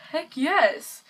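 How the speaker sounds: loud, high burst of enthusiasm; excited yes. Retold or imitated. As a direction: blurt it out as an eager acceptance. Retelling eager answer to aunt.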